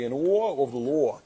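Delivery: kids mocking a New York accent, drawn out. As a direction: imitate the kids teasing Dad's New York accent, slowly. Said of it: "they like to make fun of my New York accent".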